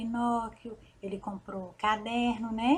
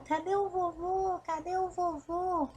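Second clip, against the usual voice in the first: desperate; 7 semitones higher, slower than usual. A narrator's reading. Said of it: "saiu desesperado".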